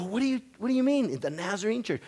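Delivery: quick, high-pitched retold what do you mean; blurting it out, caught off guard; surprised disbelief. Preacher hearing of the Nazarene church, retold surprise. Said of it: "And I go".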